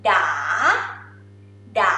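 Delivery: "da" dipping, then sweeping up; rising tone, song-like. Shown as a demonstration. Teacher showing Thai rising tone, song-like "da". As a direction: make "da" go up like a song. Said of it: "it sound like a song".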